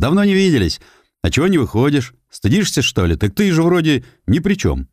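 lightly voiced nosy old neighbour. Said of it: "сосед снизу". (говорливый старик-сосед)

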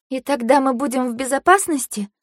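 timid high-pitched plea; thin little voice. A narrator's reading. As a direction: ask it thinly, pitch up. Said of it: "тоненько протянула Фэй-Фэй".

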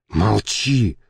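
plain reading voice

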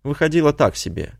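plain reading voice

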